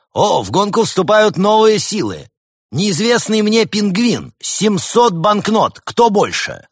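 high, loud, slow auctioneer cry; booming prize announcer. Acted. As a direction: sell it to the whole hall. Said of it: "Ведущий объявил".

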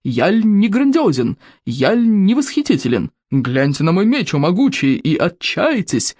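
grandiose, swooping mock-heroic declamation; pompous warlord boasting; boastful berserker chief. A narrator's reading. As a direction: show off to the crowd with sweeping intonation.

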